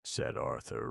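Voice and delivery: ordinary delivery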